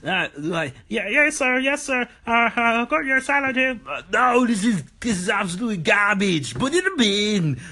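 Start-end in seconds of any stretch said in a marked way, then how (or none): 0.9-3.8 high timid cook voice; meek kitchen cook. Acted. Cook handing salad to angry chef, high meek voice. 3.9-7.6 high mock outburst at bad salad; mock angry chef. Chef Arnold rejecting cook's salad, hammed-up anger.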